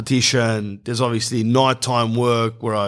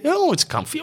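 squeaky high delighted "Oh, it's comfy"; mock saleswoman; 14 semitones higher than usual. To the customer, salesperson gushes in a mock high voice. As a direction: pitch "Oh" way up, gushing.

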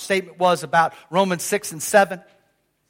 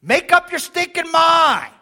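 slow, loud, high exasperated shout; 8 semitones higher, 5 dB louder, slower than usual. Acted. Preacher shouting at Paul to decide, exasperated. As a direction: throw it up to the rafters in mock frustration.

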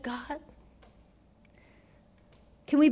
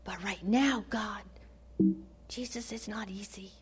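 whispered, fading confession; barely voiced, worn-out admission; broken whisper; 5 semitones lower, 8 dB quieter than usual. Desperate father confessing to Jesus.

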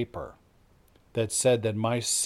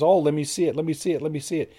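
excited pleading; 4 semitones higher, much faster than usual. Acted. Pardoned prisoner jumping up excitedly.

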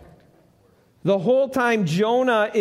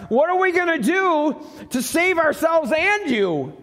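high, raised cry of panicked sailors; 7 semitones higher than usual. Acted. Sailors begging Jonah for a way out, panicked high shout. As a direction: shout it like men fearing the storm.

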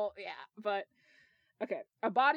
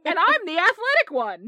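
loud, higher proud announcement; 8 semitones higher, 11 dB louder than usual. A narrator's reading. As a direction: butt in proudly, voice raised. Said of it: "James added proudly".

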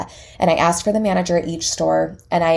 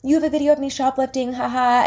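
high, put-on awkward voice; mock sheepish; 7 semitones higher than usual. Customer embarrassed before puzzled store managers, mock sheepish. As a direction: play yourself looking foolish, with a token haha. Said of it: "I look like an idiot".

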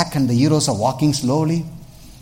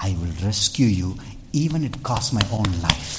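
earnest vow; 6 semitones lower, 3 dB quieter, faster than usual. Acted. Voicing Jesus' compassion.

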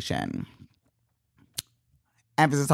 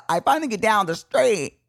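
ordinary delivery

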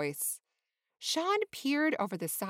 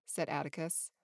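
ordinary delivery

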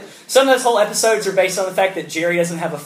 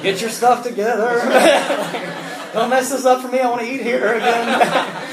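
put-on higher, louder, laughing dad warning his kids; hammed-up warning to the kids through laughter; mock stern dad; 6 semitones higher, 4 dB louder than usual. A dad warns his kids before the deli, half-laughing mock sternness. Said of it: "he's prepping the kids".

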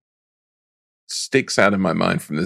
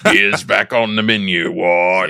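mock orc. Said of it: "one of the orcs".

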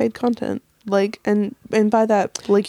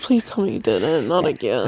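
much lower, dragged-out dismay; low dismayed groan; 10 semitones lower than usual. Acted. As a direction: groan it in mock horror. Mock dismay at fart.

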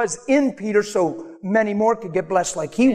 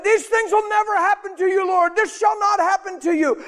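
full-force preaching; 13 semitones higher than usual. Acted. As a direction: preach it out at the top of the voice.

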